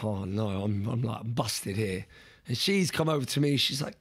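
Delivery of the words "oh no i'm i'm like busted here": low, slow mild moan; retold pained complaint. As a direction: play worn-out and defeated, understated. Retells lying collapsed in pain.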